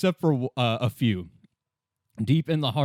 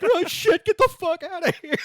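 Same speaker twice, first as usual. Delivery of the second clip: panicked shriek; 4 dB louder than usual. Acted.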